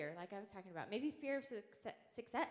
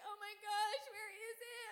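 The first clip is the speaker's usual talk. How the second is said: high, tearful mock panic; so scared; 11 semitones higher than usual. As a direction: whimper frantically, hunting for the spider. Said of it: "I'm so scared".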